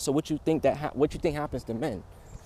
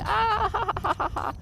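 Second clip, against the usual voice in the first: high staccato fake laugh, much higher; mock laugh. Shown as a demonstration. Showing how a happy child laughs, high and staccato. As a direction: demonstrate a baby's laugh, way up high. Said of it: "I'm gonna be laughing".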